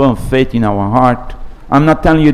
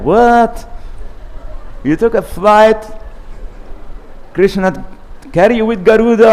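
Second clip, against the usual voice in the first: higher, astonished questioning; can't believe they beat the train; amazed disbelief; 8 semitones higher than usual. Retold or imitated. Bhagi astonished to see them already waiting, high amazed disbelief.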